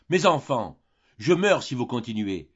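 high, loud dying plea; a father breaking under the fight; anguished cry. Acted. Old father crying out to his quarrelling daughters, anguished and loud. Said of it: "cria le vieillard".

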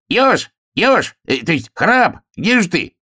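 очень высокий заячий голосок; зови друга тоненько и отрывисто; кричащий заяц. A narrator's reading. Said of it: "он закричал". (пискливый крик зайца)

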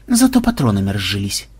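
low, resigned observation; understated, matter-of-fact consolation; philosophical. A narrator's reading. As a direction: drop the pitch and muse on the bright side. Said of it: "философски заметил Ксенобайт".

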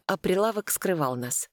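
ordinary delivery

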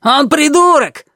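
high, slow furious yell; shouting match. Acted. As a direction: yell it right in his face. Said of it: "заорал Табаки".

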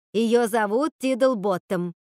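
"Тиддлботтом" loud, slow, insistent; emphatic correction. A narrator's reading. Sister insisting on the name, "Тиддлботтом" slow and emphatic. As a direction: repeat firmly, drawing out "Тиддлботтом".

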